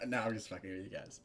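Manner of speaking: usual speaking voice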